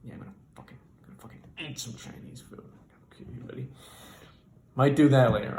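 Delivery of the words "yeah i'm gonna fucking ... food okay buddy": soft, near-voiceless grumble; muttered aside. Retold or imitated. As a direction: mutter it under your breath. Narrator's muttered inner reaction.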